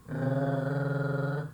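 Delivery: long rolling gargle in the throat; drawn-out uvular trill; gargled R. Shown as a demonstration. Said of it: "estivesse gargarejando".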